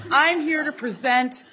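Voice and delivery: loud, high-pitched speechmaking; Wolgie impression. Impersonating the absent author before the committee, loud and high. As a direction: proclaim it loudly in a colleague's oratorical voice. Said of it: "should I do my Wolgie impression".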